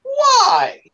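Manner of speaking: very high-pitched disbelieving cry; incredulous. Retold or imitated. Family hears of breakfast at four, high incredulous cry. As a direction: squeal it in comic disbelief.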